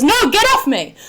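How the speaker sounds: high, loud mock outcry; retold protest. Partner fending off the German boy, high mock shout. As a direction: imitate an alarmed partner yelling. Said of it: "And she says".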